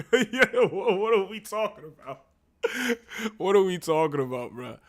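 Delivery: loud, high, cracking up mid-question; laughing incredulously. Real, not acted. Student baffled by poetry jargon, laughing incredulously. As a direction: burst out laughing in disbelief.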